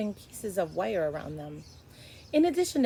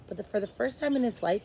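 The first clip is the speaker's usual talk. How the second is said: usual speaking voice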